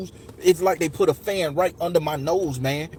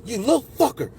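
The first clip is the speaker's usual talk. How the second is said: higher-pitched retold insult; mock angry insult; 6 semitones higher than usual. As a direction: throw out the insult, imitating an attacker. Retelling an attacker's insults.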